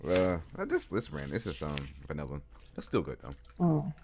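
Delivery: eating while talking; mouth full. Real, not acted. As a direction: talk through a mouthful. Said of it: "I'm talking with my mouth full".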